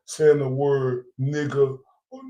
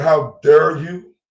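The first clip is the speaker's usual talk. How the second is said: mock outrage; 6 dB louder, much slower than usual. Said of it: "and saying".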